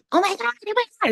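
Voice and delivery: very high, rapid squeal; panicked little elf. Retold or imitated. New elf seeing itself in the mirror, squealed panic. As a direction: gabble in tiny-elf panic. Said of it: "just like that".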